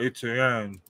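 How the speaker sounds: Etienne with a slow Cajun lilt; half-hearted Cajun accent on Etienne; attempted Cajun accent. Shown as a demonstration. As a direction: try a Cajun pronunciation of Etienne. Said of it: "try to say it with a Cajun accent".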